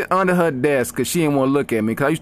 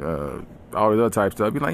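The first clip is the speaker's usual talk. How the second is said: ordinary delivery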